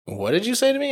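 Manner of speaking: cop's voice shoots way up in disbelief; incredulous cop. Retold or imitated. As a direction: squeak it out like a cop who can't believe his ears.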